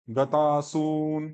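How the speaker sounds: गतासून said slowly, every syllable clear; model pronunciation. Shown as a demonstration. Sanskrit teacher modelling गतासून slowly for a student. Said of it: "गतासून नहीं".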